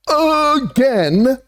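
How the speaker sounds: "again" stretched long and sung out high; drawn-out AGAIN. Shown as a demonstration. Boasting to viewers about being right, long high drawn-out "again". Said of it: "Did you hear how I said that?".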